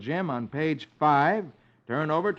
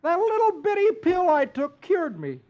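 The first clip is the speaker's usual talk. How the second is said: very high, loud cheery announcement; cheerful Dagwood; 5 dB louder than usual. Acted. Dagwood tells wife and kids he's well, high cheery voice. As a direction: beam it out.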